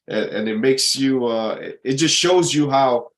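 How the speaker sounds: usual speaking voice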